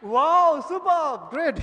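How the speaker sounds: loud, high wow of delight; shouted teacher praise, high and loud; excited shout of praise. Real, not acted. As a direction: cheer them with a big wow. Praising class after loud count.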